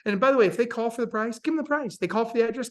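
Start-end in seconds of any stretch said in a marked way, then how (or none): none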